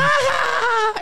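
high drawn-out mocking "ah"; shrill mock clown laugh; imitated evil laugh. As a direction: mimic a maniacal cackle way up high. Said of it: "on Happy Gilmore when he shoots".

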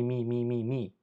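ordinary delivery

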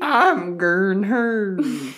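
high, slow granny voice; man doing a woman's voice. Pretend. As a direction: stretch it like an old lady. Repeating granny joke.

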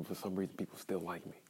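ordinary talk, nothing marked

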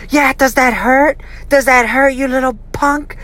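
high-pitched mocking mother voice; high, needling retold taunt; taunting mom. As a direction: imitate a mother goading her kid mid-whipping. Retold mother taunting while hitting.

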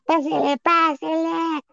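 high singsong vendor call; singsong market price calls. Retold or imitated. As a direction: chant it like a market seller. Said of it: "Che vocesota ... de vendedor". (pregón cantado de vendedor)

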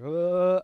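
creepy sound. Retold or imitated. Imitating creepy TikTok sound.

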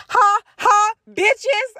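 high, loud taunting cackle at the win; gloating laugh. Real, not acted. Proud of her reply, she crows high and loud. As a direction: crow over the comeback with a shrill laugh. Gloating after a witty text comeback.

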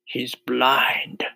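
whispered confidential aside; whispering centipede. A narrator's reading. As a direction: whisper it into his ear. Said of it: "whispering in James's ear".

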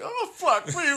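high, strained mock sob, half laughing; hammed-up breaking down over a movie; mock crying. Husband imagining himself at the movie, hammed-up high crying. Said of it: "I'm gonna be like".

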